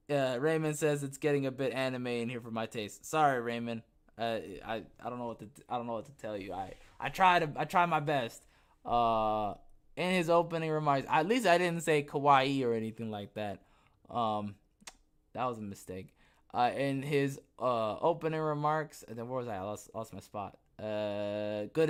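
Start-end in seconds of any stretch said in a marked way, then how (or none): none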